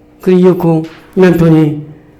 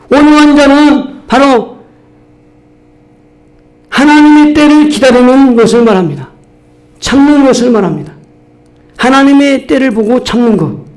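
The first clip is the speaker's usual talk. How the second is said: emphatic preaching; 6 semitones higher than usual. Real, not acted. Preaching emphasis, slow and raised.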